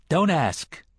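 sharp rebuke to a prying question. A narrator's reading. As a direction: cut him off sharply.

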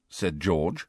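usual speaking voice

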